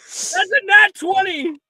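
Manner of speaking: high, loud cheer over a roll; thrilled shout. Real, not acted. Game master cheering the dice roll, thrilled shout. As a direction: celebrate the natural twenty.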